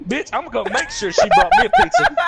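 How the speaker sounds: a mock yell, high and fast, breaking into laughter; laughing mock Wayne. Drunk buddy demanding pizza, high laughing mock yell.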